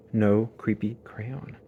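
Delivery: plain reading voice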